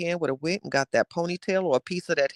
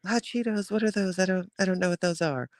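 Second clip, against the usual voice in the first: higher, dainty puzzled mock Janet; Janet imitation; 4 semitones higher, 4 dB quieter than usual. Mocking Janet's interview answer, high and puzzled. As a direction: play Janet acting clueless. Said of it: "Janet was like".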